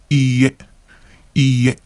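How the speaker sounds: Iie with the final e stretched out; lengthened final e; long e. Shown as a demonstration. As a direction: draw out the e in Iie. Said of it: "extend the E syllable".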